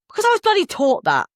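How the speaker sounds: high-pitched exasperated mock retort; indignant retort. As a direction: throw it back, offended. Retold argument with counselor.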